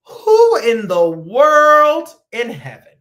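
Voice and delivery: high, loud, slow outraged cry; loud disbelief. Real, not acted. Host reacts to jelly on egg sandwiches, loud slow outrage.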